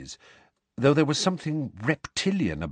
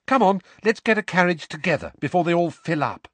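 put-on higher brisk girl voice; brisk Hermione; 7 semitones higher, faster than usual. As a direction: hurry them along as Hermione. Hermione hurries friends along.

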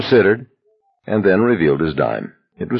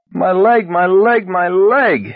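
screaming in pain; 13 semitones higher, slower than usual. A narrator's reading. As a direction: scream as pain shoots up the leg. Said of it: "he screamed".

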